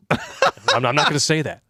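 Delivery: normal talking voice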